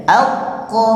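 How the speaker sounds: al hold the k, then release into ko; held qalqalah. Shown as a demonstration. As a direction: stop on the k in al, then bounce out ko. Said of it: "Tahan dulu, baru lepas".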